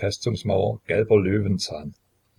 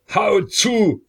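much higher, loud furious scream; screamed order; 13 semitones higher, 7 dB louder than usual. A narrator's reading. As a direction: scream it in a rage. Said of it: "schrie er wieder".

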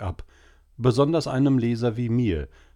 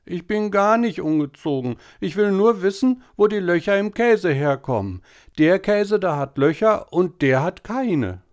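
put-on high, louder whining boy; whiny boy shouting; 11 semitones higher, 5 dB louder than usual. A narrator's reading. Tobi shouting his question at papa, high and loud. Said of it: "nicht so zu brüllen".

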